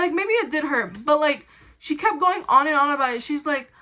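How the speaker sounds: normal talking voice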